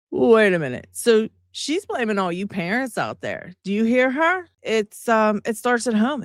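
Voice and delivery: normal talking voice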